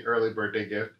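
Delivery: usual speaking voice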